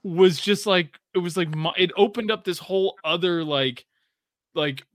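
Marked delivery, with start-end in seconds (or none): none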